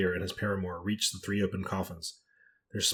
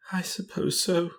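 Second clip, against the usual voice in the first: high, slow, helpless murmur; tearful murmur; 10 semitones higher, slower than usual. A narrator's reading. Crying companion admitting her misery, tearful murmur. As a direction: draw it out high, close to tears. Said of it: "she murmured".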